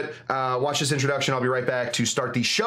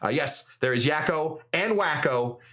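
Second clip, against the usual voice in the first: usual speaking voice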